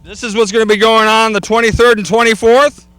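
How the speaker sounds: normal talking voice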